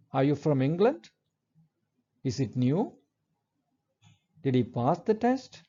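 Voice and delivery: each yes-no question ends on a rising tone; rising question tune. Shown as a demonstration. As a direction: lift the pitch up at the end of each question. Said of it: "a rising intonation is used".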